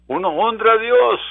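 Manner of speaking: crying it out to the congregation; preached climax. Acted. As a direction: build it up and proclaim. Repeated phrase, climactic.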